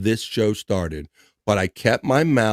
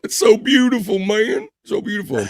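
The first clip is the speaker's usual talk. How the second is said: pitched way up, hammed-up weepy praise; mock teary gush; 11 semitones higher than usual. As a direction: pretend to choke up over a song. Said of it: "This song makes me tear up".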